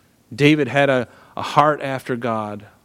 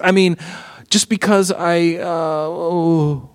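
higher, anxious begging to save face; lightly voiced king pleading, rushing at the peak; pleading Saul; 6 semitones higher than usual. Saul begging Samuel to come back, nervous plea. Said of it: "So now Saul is like".

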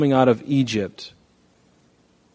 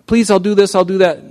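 high, louder whiny begging; mock child pleading, pitched up; 8 semitones higher, 6 dB louder than usual. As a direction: beg like a small kid.

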